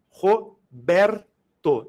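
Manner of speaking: "Roberto" split into three slow beats, Ro, ber, to; syllable by syllable. Shown as a demonstration. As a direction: break "Roberto" into separate syllables with clear pauses. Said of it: "três emissões sonoras".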